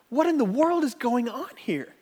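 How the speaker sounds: can't believe what he's hearing; incredulous. Acted. Preacher reacting to the servant's cruelty, incredulous.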